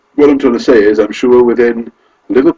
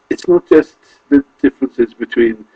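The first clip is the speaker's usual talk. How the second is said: usual speaking voice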